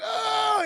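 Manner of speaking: loud, high, drawn-out "oh"; mock scream. Bo as the disposal turns on, mock scream. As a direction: imitate a howl of agony.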